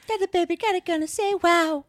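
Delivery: high, far-off [name] imitation; sing-talk like [name]; [name] impression. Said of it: "That was [name]".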